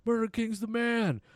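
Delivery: high, loud cheering voice; mock shouted invitation, pitched high; excited fighter. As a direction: hype him up like a pumped fighter. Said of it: "he's like".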